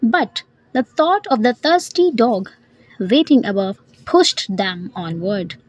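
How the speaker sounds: ordinary delivery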